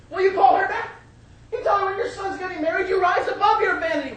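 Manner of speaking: loud, high-pitched insistence; angry fiancée. Acted. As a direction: demand it like an exasperated fiancée. Fiancée argues with Ivan.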